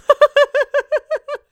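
very high, loud burst of laughter; shrieking laugh. Real, not acted. Cracking up at the chat insult, shrieking laugh.

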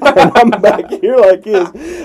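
slow, high and loud through laughter; telling it while cracking up; laughing while talking. Real, not acted.

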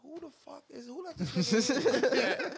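high-pitched disbelief building to a shout; incredulous outrage. Retold or imitated. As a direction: start high, end loud and drawn out. Retold outrage at underdressed guest.

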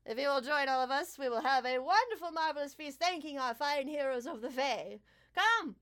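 high, showy invitation to the feast; grand host king. Acted. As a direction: sweep everyone to the table. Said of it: "And he smiles".

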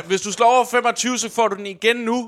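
high, urgent game master commentary; excited match narration. Acted. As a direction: drive the attack forward at full voice.

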